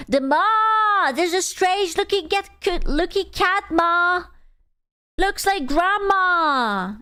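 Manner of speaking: high, drawn-out "ma"; mimicked cat cry. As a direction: imitate a wailing meme cat, slow and high.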